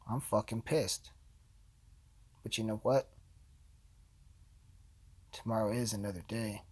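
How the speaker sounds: low, quiet resignation after a rant; subdued anger. Real, not acted. Deflated after rant about award.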